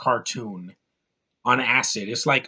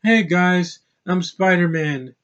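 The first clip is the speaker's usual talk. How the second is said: phoned-in line; 3 semitones higher, slower than usual. Retold or imitated. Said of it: "He's not freaking phoning in it".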